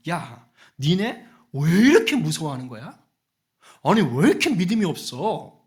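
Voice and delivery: incredulous, exasperated telling-off; exasperated rebuke. Acted. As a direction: act out a teacher chiding frightened followers. Acts out Jesus rebuking disciples.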